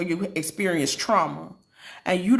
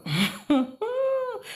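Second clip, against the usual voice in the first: emotional laugh; 12 semitones higher than usual. Real, not acted. Speaker recalling a painful surrender to God, emotional laugh. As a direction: laugh that breaks into a thin high sound.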